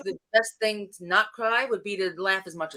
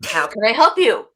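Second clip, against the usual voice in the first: mock salesy line; 8 dB louder than usual. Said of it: "what's the famous line".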